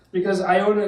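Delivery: ordinary talk, nothing marked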